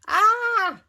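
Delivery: startled high loud cry; shout. Acted. Dad startled by the train bursting in, shout. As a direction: jump out of your skin. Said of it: "shouted Dad".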